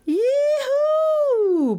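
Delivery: very high, long joyful yee-hoo; high-pitched barfly's whoop of triumph; joyous whoop. A narrator's reading. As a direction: let out a gleeful high holler. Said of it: "Bert yelled joyously".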